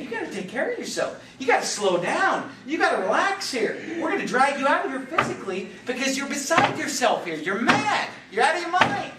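high, insistent pleading that builds; acted urgent scolding. Friends confronting an overworked Jesus, urgent scolding. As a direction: press him harder with every phrase.

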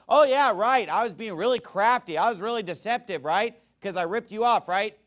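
loud, high mock agreement; sarcastic. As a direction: pretend to admit it all, dripping sarcasm. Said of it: "So he's being sarcastic".

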